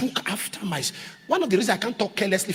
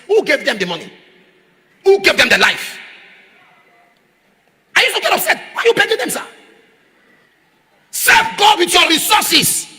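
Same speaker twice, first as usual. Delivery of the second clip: rising, louder rebuke; indignant retort; 9 semitones higher, 6 dB louder than usual. Retold or imitated. Preacher retelling his retort, rising to a shout. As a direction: scold them for begging, voice climbing.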